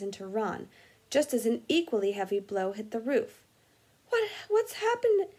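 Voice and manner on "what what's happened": very high, crying gasp of fright; gasped in shock. Acted. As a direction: blurt it out in terror. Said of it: "Ron gasped".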